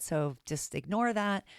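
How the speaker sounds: ordinary delivery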